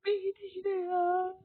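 high, weepy complaint; crying voice, pitched high; tearful. Real, not acted. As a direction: keep whining it through tears. Still crying after the loss.

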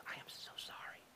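whispered, ashamed apology; sheepish whisper. Retold or imitated. Realizing you misjudged someone, whispered apology. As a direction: breathe it out like you've just been caught out. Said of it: "and you go".

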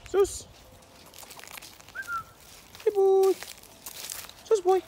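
high-pitched call to the dog. Real, not acted. Calling the dog to play.

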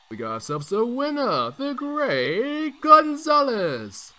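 booming, drawn-out ring announcer; announcer call. Pretend. Ring announcer to the crowd, booming slow winner call. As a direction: stretch the winner's name out for the crowd.

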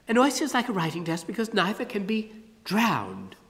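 put-on bright curious child working it out; lightly voiced girl Ada. Ada puzzles out the riddle, lightly voiced as a girl.

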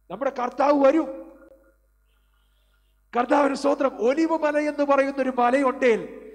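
loud, high, fiery proclamation; fervent preaching shout. Real, not acted. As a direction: preach it out full force to the congregation. Preacher rising to climax.

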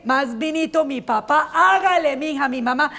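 ordinary talk, nothing marked

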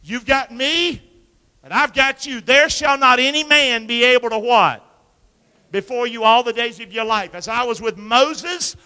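loud, slow preacher's cry; preaching at full force. Acted. Pastor preaching the promise to the congregation, loud and slow. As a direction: proclaim it to the whole church.

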